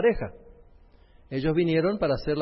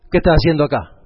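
sudden loud, stern outburst; angry retold shout; 13 dB louder than usual. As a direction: bark it like an angry father. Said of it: "me empezó a gritar".

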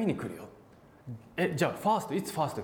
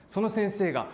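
ordinary delivery

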